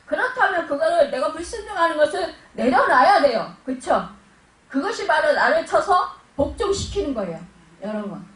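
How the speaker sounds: ordinary delivery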